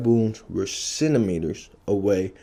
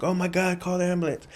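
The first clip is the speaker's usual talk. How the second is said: higher, urgent frightened friend; panicked girls; 9 semitones higher, faster than usual. Retold or imitated. Girls calling for an ambulance, high urgent panic. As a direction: cry out to call for help.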